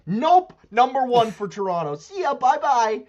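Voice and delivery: high, loud gloating send-off; loud, high-pitched sports taunt; taunting dismissal. Real, not acted. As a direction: crow over the rival fans' bad luck. Taunts Boston fans.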